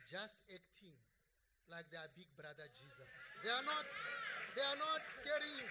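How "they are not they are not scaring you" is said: loud, higher, emphatic preaching; forceful preaching. Real, not acted. Preacher driving home a point to church, forceful preaching. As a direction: preach it out to the congregation with fire.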